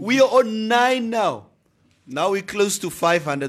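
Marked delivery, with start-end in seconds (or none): 0.0-1.5 high, stretched-out cry of shock; mock alarm. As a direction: announce it as if it were a catastrophe. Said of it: "all of us went through these emotions".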